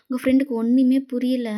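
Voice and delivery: plain reading voice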